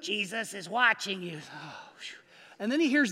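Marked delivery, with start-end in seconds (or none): 0.0-1.4 low, inhuman talking-bird voice; eerie parrot voice. Acted. Parrot in the dark warning the robber, low and eerie. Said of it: "he sees the bird's beak move". 1.4-2.2 a breathless whispered sigh of relief; relieved whisper. Acted. Burglar whispering relief on finding it's only a bird.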